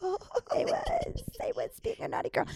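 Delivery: high, breathy coy whisper; coy naughty girl voice. Acted. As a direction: play the naughty girl teasingly. Playful naughty-girl reply.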